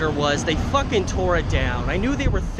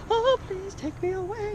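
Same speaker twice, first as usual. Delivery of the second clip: high, swooning mock-serenade voice; mock serenading singer. Singer mock-serenading his dad at dinner, high and theatrical. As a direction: put on a very high, theatrical lovesick plea. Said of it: "started doing this, like, mock song".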